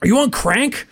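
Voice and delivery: high, put-on dad voice; mock dad accusation. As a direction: accuse the son, hammed up. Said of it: "And he goes".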